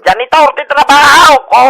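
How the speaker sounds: very loud megaphone proclamation; blaring megaphone. Acted. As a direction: blast it over the whole street. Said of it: "Sapay nagpigsa mo ti megaphone mo". (napigsa unay nga pukkaw iti megaphone)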